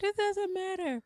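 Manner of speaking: high-pitched mock quote; dismissive quote. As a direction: squeak it out, imitating a friend. Quoting Jay's dismissal.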